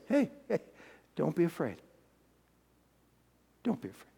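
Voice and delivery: very soft, falling angel voice; gentle reassurance. Acted. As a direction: hush her fears softly, letting it drop away. Angel soothes frightened Mary.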